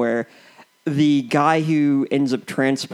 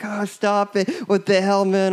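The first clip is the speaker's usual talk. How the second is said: higher pitched, mildly annoyed mock yell; a hint of exasperated shouting; 7 semitones higher than usual. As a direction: imitate friends yelling at a pal, half-hearted. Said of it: "they're, like, yelling at him".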